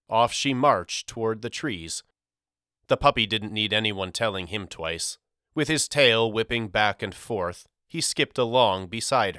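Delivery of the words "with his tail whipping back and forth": plain reading voice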